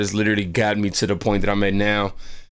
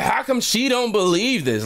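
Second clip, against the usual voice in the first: high-pitched incredulous question; retold baffled indignation; pissed off; 12 semitones higher than usual. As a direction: ask it in exasperated disbelief, voice up high. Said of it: "I'm pissed off at this woman".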